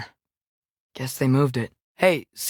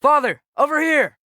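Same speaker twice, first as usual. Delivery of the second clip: loud, higher call across a distance; 10 semitones higher, 5 dB louder than usual. Acted. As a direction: shout to get father's attention. Calling to father across distance.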